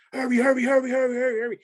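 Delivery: high, urgent repeated yelling; frantic yelling. Retold or imitated. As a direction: rush them out with high cries. Recruits rushing off bus.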